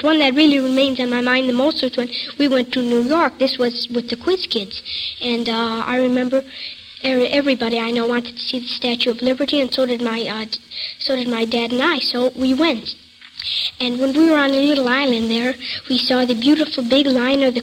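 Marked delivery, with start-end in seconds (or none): none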